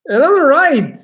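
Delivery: high and loud, sure of himself; triumphant. Real, not acted. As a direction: declare it like a winner. Triumphant claim after listing reasons.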